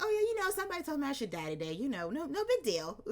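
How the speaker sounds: high, fast, brushing it off; breezy James imitation. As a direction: toss it off like nothing. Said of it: "James was just kinda like".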